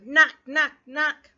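loud, punchy knock knock knock. Acted. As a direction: rap out each knock hard. Knocking on dragon's door.

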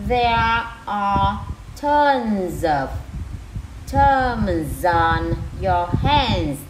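slow, loud chunks with pauses between; word by word. Shown as a demonstration. Teacher rereads for the moms, word by word. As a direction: read it out piece by piece, very slowly. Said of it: "từng từ ha".

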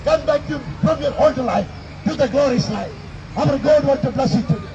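usual speaking voice

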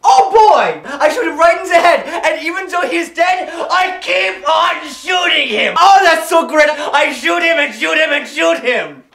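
put-on excited German-accented shouting; hammed-up triumphant rant; manic gloating. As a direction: rave gleefully, carried away by the tale. Pilot gloats, carried away.

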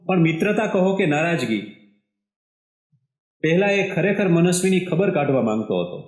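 usual speaking voice

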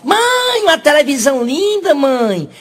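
put-on very high-pitched little kid calling mom; kid's gushing sing-out to mother; excited child. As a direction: pitch it way up, drawn out like an eager child.

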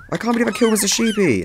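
high lament; dismayed whine. Real, not acted. Player lamenting Mister Sheepy to viewers, high dismayed whine. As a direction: whine about your own blunder, high voice.

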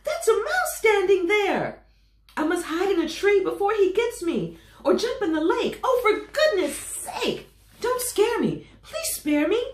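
high, loud frightened pleading; terrified shrieking. Acted. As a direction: elephant begging the mouse, high, loud and scared. Elephant terrified of mouse.

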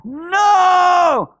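high, stretched-out shout; yelled out. Retold or imitated. Preacher re-enacting mother's answer to the doctor, long high yell. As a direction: hurl it out as a long refusal. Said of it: "She yelled out".

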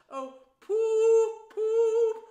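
very high, very loud, long hoot; ecstatic hooting. Acted. Toad imitates the car in bliss, ecstatic hoot. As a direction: sing it out like a horn.

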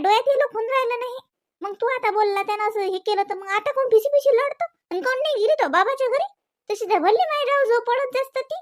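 high, loud furious scolding; angry scolding. Acted. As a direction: rail at the daughter-in-law, pitch rising high. Mother-in-law scolding daughter-in-law. (रागाने उंच आवाजात ओरडून बोलणे)

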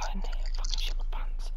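shaken breathless whisper; whisper, still rattled. Real, not acted. After the scare.

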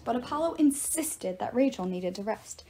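ordinary delivery

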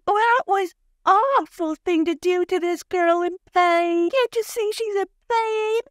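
high, mock-tearful older lady; mock outraged lament. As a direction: wail it in hammed-up indignation. Mimics Miss Debbie's outraged lament.

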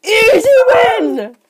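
slow, high, loud gloating shout; triumphant shout. Real, not acted. Kid crows over beating his friend, a slow loud high shout. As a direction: stretch it out and crow the win. Gloats after winning the round.

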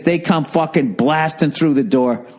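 ordinary talk, nothing marked

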